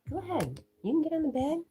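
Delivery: high, bright invitation to the cat. Real, not acted. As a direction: coax the pet up onto the bed, way up high. Baby talk inviting pet onto bed.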